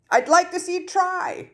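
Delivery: high, taunting dare; daring yell. A narrator's reading. Students daring Kingsley to fight them, high taunting yell. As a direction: throw the dare back at him. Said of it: "more than one student yelled in a straight-out dare".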